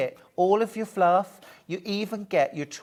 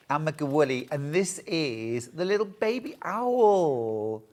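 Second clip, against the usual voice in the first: ordinary talk, nothing marked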